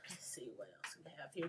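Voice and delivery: barely voiced mumble to self; whispered aside. Real, not acted. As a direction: whisper it to yourself while looking through notes. Muttering to self while checking notes.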